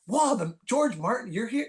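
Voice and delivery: high-pitched amazed exclamation; starstruck fan. Retold or imitated. Imagined fan spotting the Beatles' manager, starstruck exclamation. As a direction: gush in mock disbelief.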